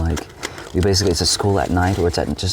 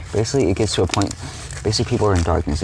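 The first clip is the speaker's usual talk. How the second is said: normal talking voice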